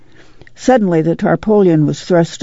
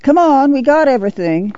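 calling out; 8 semitones higher than usual. A narrator's reading. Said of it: "Ma called".

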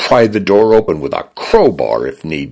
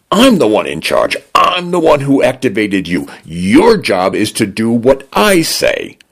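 raised, indignant voice, pitched up; fuming shout; 6 semitones higher than usual. A narrator's reading. Dinah shouts at her locked-out door, fuming. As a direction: demand control back, fuming. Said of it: "she shouted".

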